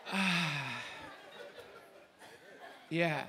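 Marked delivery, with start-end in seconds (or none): 0.1-0.8 soft, low sighed ah; contented sigh. Shown as a demonstration. Showing the opposite of the scream, contented sigh. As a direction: let out a long relieved ah. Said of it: "It's like the opposite".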